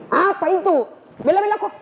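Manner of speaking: sharp, high question; high demanding Umar. Acted. Umar demands to see the scripture, high and pressing. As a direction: press hard to know what it is.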